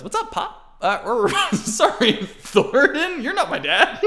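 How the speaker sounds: put-on very high giggly little boy voice; kid Aiden laughing. Aiden greeting Thornton as pop, laughing. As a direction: chirp it while cracking up.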